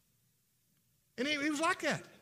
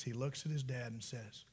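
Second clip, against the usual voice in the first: usual speaking voice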